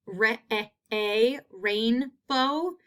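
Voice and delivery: "re-, a, a" stretched slow to pull out the long A; sounding out. Shown as a demonstration. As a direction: break "rainbow" apart, hold each A. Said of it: "identify the long A sound".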